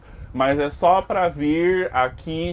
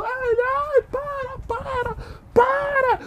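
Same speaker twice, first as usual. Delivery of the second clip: much higher squealing plea in a put-on voice; hammed-up victim pleading; slower than usual. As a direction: whine for them to stop, hammed up. Voicing the beaten customer. (vítima implorando, voz bem aguda e exagerada)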